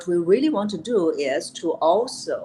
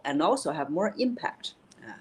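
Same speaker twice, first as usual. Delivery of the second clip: ordinary talk, nothing marked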